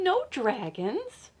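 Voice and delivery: ordinary delivery, nothing marked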